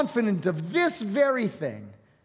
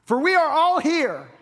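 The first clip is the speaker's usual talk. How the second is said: shouted much higher and louder to reach the jailer; loud, higher urgent yell stopping a man from harming himself; 8 semitones higher, 5 dB louder than usual. Retold or imitated. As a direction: call out across the pitch-dark prison. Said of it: "Paul yells out".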